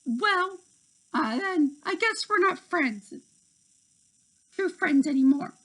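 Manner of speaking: high, hurt retort; hurt Tink. A narrator's reading. Tink breaking off with Terrence, high hurt voice. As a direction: say it high, wounded and defiant.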